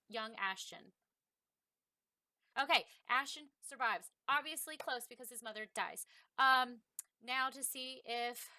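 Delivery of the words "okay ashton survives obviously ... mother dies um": ordinary talk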